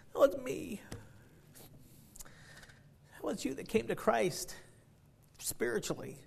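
high, soft, emotional voice; slow and tender, overcome by it; moved, choked up. Real, not acted. Preacher moved after the story.

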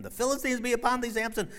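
high, loud, fast warning; alarmed cry. Acted. Delilah warning Samson, fast high cry. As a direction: call out the Philistines are coming.

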